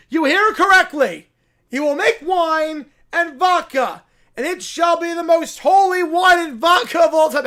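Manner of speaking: high, loud zealot sermon to the rats; rally the rodents like a mad prophet; preaching bear. Acted. Bear answers Banjo.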